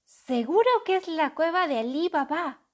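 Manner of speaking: loud, high excited exclaim. A narrator's reading. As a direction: burst out with delight. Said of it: "exclamó".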